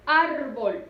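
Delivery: "árbol" with a loud, drawn-out ÁR; "árbol" in two syllables, first one stretched; stressed first syllable. Shown as a demonstration. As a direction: lean hard on the ÁR of "árbol". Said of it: "Lleva su sílaba tónica en la penúltima".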